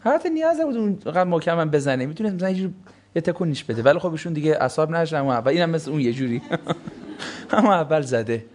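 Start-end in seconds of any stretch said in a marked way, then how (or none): none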